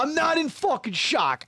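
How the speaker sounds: high, indignant replayed protest; angry retort. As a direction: act out refusing the diagnosis angrily. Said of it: "made me so angry".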